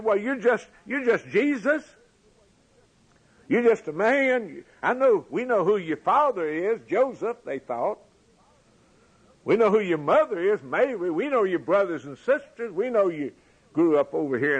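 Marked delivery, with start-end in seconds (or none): 0.0-7.4 higher, dismissive voice of the doubters; mocking villager, pitched up; scoffing crowd. 9.4-14.6 higher, dismissive voice of the doubters; mocking villager, pitched up; scoffing crowd.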